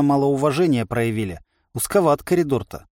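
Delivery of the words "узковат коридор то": usual speaking voice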